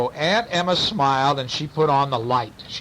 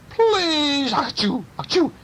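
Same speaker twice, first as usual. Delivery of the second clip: very high whimpering plea with sneezes; beg, overtaken by mock sneezes; pleading burglar; 11 semitones higher, slower than usual. A narrator's reading. Burglar begs aunt for mercy, sneezy pleading. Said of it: "said the burglar".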